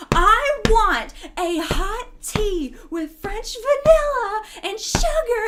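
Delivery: slow, high, loud pounded-out demand; furious customer imitation. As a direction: act out an enraged customer hammering each word. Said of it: "starts beating on her steering wheel".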